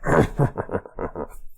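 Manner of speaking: muffled cackle. Shown as a demonstration. Steve's hand over his mouth can't stop the low muffled cackle. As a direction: cackle through a covered mouth. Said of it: "even if he tries to cover his mouth, it's still just".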